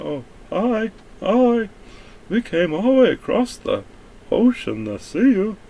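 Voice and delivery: high, sugary mock-innocent voice; mock naive greeting. Mocking naive friendly settlers.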